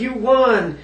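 high, sing-song mock scammer voice. Mocking the lottery scammer's pitch to the viewers. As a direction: squeak it out like a fake prize caller. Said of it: "They're like".

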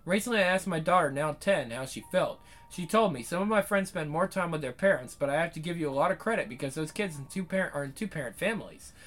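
quick, low, even read of a written essay; reading someone's article aloud to viewers. Acted. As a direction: read the piece through at a steady clip.

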